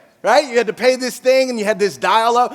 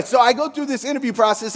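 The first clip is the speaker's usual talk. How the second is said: ordinary talk, nothing marked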